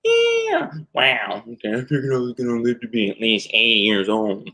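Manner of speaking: a hint of a bemused dude meeting God; Owen Wilson. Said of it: "so these are celebrities meeting God".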